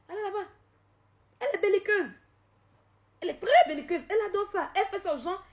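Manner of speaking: high, loud mocking voice. Real, not acted. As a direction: jeer it high at her. Mocking the rival.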